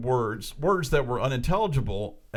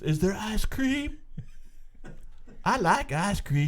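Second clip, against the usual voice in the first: higher, slow dim-witted voice; simple-minded old man; 7 semitones higher, slower than usual. Retold or imitated. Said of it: "Simple Joe".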